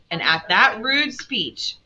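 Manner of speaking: plain reading voice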